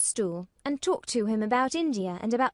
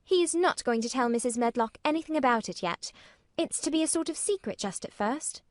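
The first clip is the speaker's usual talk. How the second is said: put-on higher girl's voice, firm and quick; firmly; 6 semitones higher, faster than usual. A narrator's reading. Mary firmly tells Martha it's a secret. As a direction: state it as settled. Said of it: "said Mary firmly".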